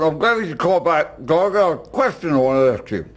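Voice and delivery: talking through a mouthful of cookies; muffled, chewing while he speaks; mouth full. Acted. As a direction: mumble it round a big bite. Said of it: "I cannot understand a word you're saying".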